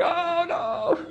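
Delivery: very high startled cry; Peter's dismay. Acted. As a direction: gasp it in dismay. Rooster moment, Peter realizes.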